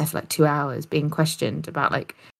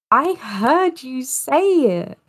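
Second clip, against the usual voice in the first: high, slow imitation of the husband; mock husband; 8 semitones higher, slower than usual. Imitating the husband telling his wife he heard her, high and slow. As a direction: drag it out in a put-on voice.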